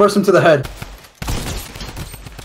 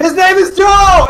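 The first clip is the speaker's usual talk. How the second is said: teasing shout; 11 semitones higher, 6 dB louder, slower than usual. Real, not acted. Teasing friend to fans.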